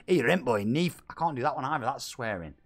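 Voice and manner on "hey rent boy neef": loud Scottish call; Scottish accent. Shown as a demonstration. Streamer trying another line on request, loud Scottish accent. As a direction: bark it out in mock Scots. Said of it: "swearing in my Scottish accents".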